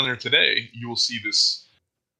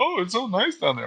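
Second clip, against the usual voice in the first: higher, gushing mock tourist; imitating tourists; 8 semitones higher than usual. Host mimicking tourists who gush about Florida. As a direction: gush like a naive visitor. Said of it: "everyone's like".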